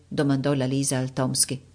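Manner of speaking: ordinary delivery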